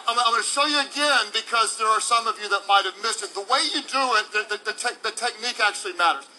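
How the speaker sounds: normal talking voice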